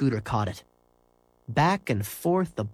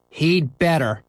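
louder, terse grim warning; a grim threat; grimly; 4 semitones higher, 5 dB louder, slower than usual. Acted. Scooter waits for the car, grim and louder. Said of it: "said Scooter grimly".

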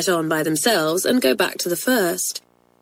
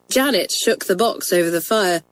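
ordinary delivery

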